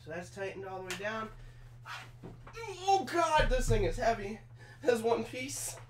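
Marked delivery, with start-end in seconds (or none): 2.9-5.8 straining under weight. Real, not acted. Lifting heavy chair piece.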